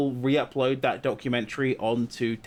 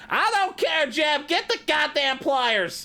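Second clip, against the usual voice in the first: much higher, slow bossy drawl; George Bush ordering Jeb; 13 semitones higher, slower than usual. Acted. George Bush ordering Jeb to get the pliers, high and slow. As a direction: boss him around in a high, slow voice.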